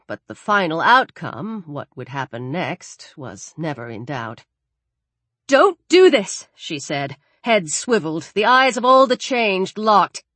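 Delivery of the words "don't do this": higher, louder desperate appeal; urgent plea. Acted. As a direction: plead with them to stop. Pleading with the changed.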